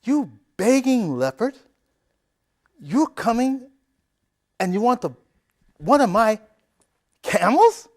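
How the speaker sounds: high, loud furious scolding; angry shout. Acted. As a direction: tear into the beggar. Rich man scolding the beggar.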